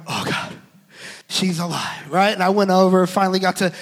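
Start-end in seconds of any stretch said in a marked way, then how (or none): 0.1-2.1 very low, soft murmur of relief; awed, understated thankfulness; hushed relief. Retold or imitated. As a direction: let it out quietly, voice dropped right down. Relief on hearing baby cry.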